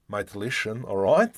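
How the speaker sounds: lightly exaggerated Australian wog accent; hammed-up wog accent. Shown as a demonstration. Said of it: "I can ham up the fully, the wog".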